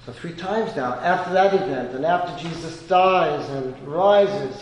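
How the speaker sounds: ordinary talk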